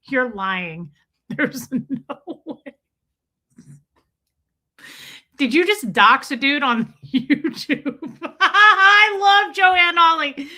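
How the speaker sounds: helpless laughter through the words; real giggling fit while talking; laughing hard. Real, not acted. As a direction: crack up, rising to a high, loud laughing cry. Cracking up at a donated joke.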